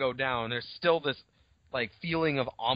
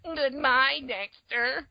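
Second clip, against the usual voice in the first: very high put-on cartoon voice; another cartoon Dexter; 10 semitones higher, much slower than usual. Host doing Dexters' goodbyes, high cartoon voice. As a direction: squeak out a goodbye as a cartoon clone. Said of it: "all the Dexters saying goodbye to each other".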